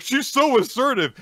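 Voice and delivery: high, loud fanboy gush; mock swooning. Host mocks all the guys admiring her, mock swooning.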